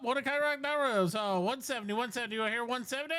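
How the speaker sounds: fast auction patter calling bids; auctioneer. Acted. Auction continues.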